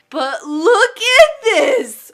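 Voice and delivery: high, slow laughing squeal; excited laughing squeal. Real, not acted. Shows off her new hair.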